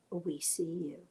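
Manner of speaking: whispered, soft and breathless; children's whisper. Retold or imitated. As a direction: breathe it like a child telling a secret. Children confronting the adults.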